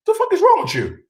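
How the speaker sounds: high incredulous snap; can't believe what he's hearing; high exasperated jab. Real, not acted. Heated rant at Miko.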